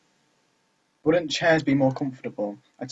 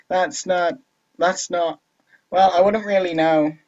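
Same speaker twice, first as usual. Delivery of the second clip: put-on higher, strained-for girl character voice; 5 semitones higher, 6 dB louder, slower than usual. Natsuki admits she wouldn't know, in awkward put-on voice. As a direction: pitch up into an awkward game character. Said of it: "I can't do this voice".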